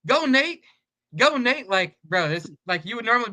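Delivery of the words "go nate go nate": high, raised mock cheer; imitated sideline yelling. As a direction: imitate teammates hollering from the sideline. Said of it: "They're literally yelling".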